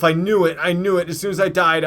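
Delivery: angry gamer venting loudly; real annoyed outburst. Real, not acted. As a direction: keep yelling at your own blunder. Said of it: "I'm so pissed at myself".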